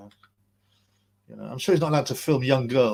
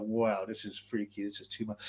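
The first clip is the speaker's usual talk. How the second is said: quiet mock quote; 5 semitones lower, 10 dB quieter than usual. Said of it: "And he's like".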